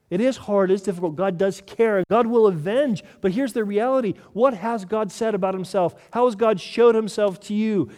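impassioned sermon delivery; preaching at full force. Acted. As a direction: build it like a pulpit climax.

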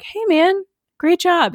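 high, eager mock compliment; imitated fan greeting. Retelling a fan greeting a passing celebrity, high mock-eager. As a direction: call out praise in a put-on high voice.

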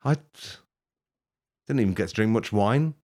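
ordinary delivery

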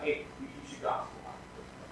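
unvoiced tempter's whisper. Preacher voicing a tempter whispering gossip. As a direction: breathe it slyly into an ear. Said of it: "whisper in your ear like".

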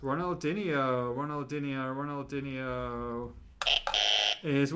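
slow, drawn-out game-show repeat; suspenseful host. Pretend. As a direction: stretch each repeat out to tease the reveal. Host stalls before revealing the answer.

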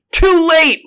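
very high, loud shout; desperate cry. A narrator's reading. Doctor answering as the monster reaches the crack, desperate cry. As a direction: cry out as the chance slips away. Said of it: "replied the doctor".

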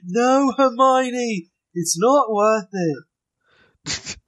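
high, very slow put-on British plea; mock Ron. Mocking Katie's bad Harry Potter voices, slow and high. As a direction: drag it out, silly and pleading.

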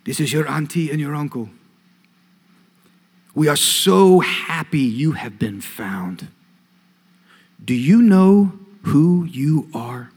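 slow, lightly put-on West African accent of an aunt; a hint of a West African auntie, drawn out; thick African accent. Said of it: "this thick African accent".

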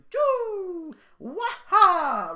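high, loud cartoon yelps; squeaky plumber jump yells; Mario barks. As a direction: bark it like a jumping plumber. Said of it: "he's got all these different little barks".

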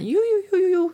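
a high, fast, trilled "呦呦呦呦呦". Shown as a demonstration. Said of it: "发出这个呦呦呦呦呦呦这样子的一个，一个声音".

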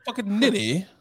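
high mock-British insult; British accent. Trading British slang with co-host, high British accent. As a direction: toss it off in put-on British. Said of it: "give me your British accent".

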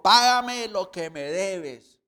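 loud, slow threatening demand; furious creditor shouting at his fellow servant; angry demand. Retold or imitated. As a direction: spit out the demand at the debtor. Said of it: "le ahogaba diciendo".

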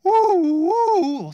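high, loud gasping oh; relieved cry. Real, not acted. Relief as patient revives.

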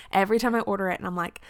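ordinary delivery

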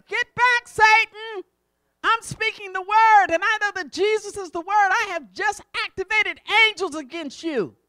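loud, high shouted command; commanding rebuke. Acted. Believer ordering Satan back, in a loud commanding rebuke. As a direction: order the enemy away with authority.

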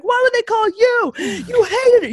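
high, loud, cutting shout; furious shout. Retold or imitated. Daughter yelling at her mom on the phone, furious. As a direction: throw the blame back at her. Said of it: "I was like".

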